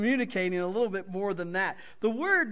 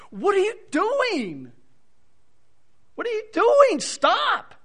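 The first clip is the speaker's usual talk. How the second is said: much higher, louder pleading shout; emotional protest; 11 semitones higher, 8 dB louder than usual. Shown as a demonstration. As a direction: cry out in anguished protest. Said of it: "it's a strong emotional protest".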